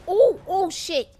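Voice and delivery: loud, slow, drawn-out startled oh shit; jolted by a near miss, blurting it out; startled shout. Real, not acted. Nearly hit in the parking lot, startled loud curse.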